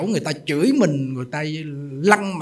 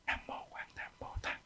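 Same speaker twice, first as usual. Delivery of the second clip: breathy whispered chant of the Bodhisattva's name; silent recitation whisper; 17 dB quieter than usual. Shown as a demonstration. Monk showing the faithful how to recite silently, in a whisper. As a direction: whisper the invocation under your breath. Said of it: "phải niệm thầm".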